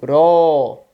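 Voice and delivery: "ró" long and loud; stressed syllable. Shown as a demonstration. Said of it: "a tônica da palavra".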